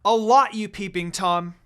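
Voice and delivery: loud, indignant yell; angry shout. Acted. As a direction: yell the insult at him. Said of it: "she kept shouting at him".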